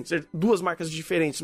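usual speaking voice

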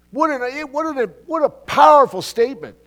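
high, loud burst of awe; excited exclamation. Real, not acted. Preacher amazed by the verse, exclaiming loudly. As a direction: marvel out loud at the words.

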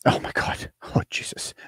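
unvoiced-sounding whispered groan of disbelief; hushed dismay. Real, not acted. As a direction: whisper it, appalled, then a low laugh. Muttered dismay at the photo.

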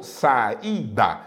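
saída stretched slowly into sa-í-da; saída, slow, each vowel in its own syllable. Shown as a demonstration. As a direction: break saída into three separate syllables. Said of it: "se você separar em sílabas".

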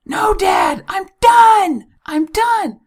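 put-on high, loud little-girl shout, slow; imitating a three-year-old yelling; daughter yelling. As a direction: call out like a toddler from the bathroom. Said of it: "my daughter yelling".

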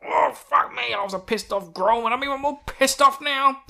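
hammed-up pissed-off creature; angry Grolm. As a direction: rant like an enraged beast. Voicing enraged Grolm.

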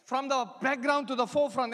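ordinary talk, nothing marked

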